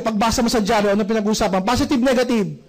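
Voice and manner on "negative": normal talking voice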